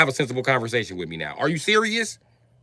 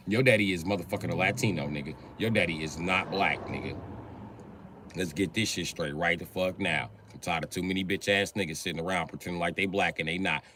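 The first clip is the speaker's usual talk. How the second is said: usual speaking voice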